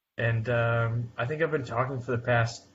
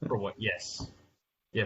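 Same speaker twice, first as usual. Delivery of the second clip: ordinary talk, nothing marked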